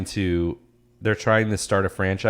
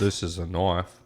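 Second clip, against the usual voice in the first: Dundee quote; 5 dB quieter, slower than usual. Retold or imitated. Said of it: "Or you just say".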